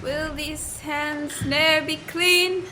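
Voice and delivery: slow, hushed, faraway plea; sleepwalking lament. Acted. Sleepwalking queen on stage, hushed and slow. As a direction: ask it to nobody, still asleep. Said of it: "Your sleepwalking scene".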